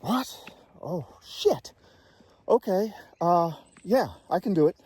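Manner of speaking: high, loud, slowed gasp of disbelief; stunned shock. Retold or imitated. Airman hears his friend's plane crashed, stunned high shock. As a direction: let the news hit you mid-word. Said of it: "I was stunned".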